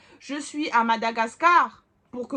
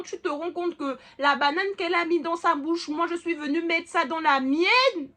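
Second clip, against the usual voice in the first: higher, louder, indignant version; 6 semitones higher, 3 dB louder than usual. Retold or imitated. Daughter telling her mother, louder and indignant. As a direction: push the outrage up.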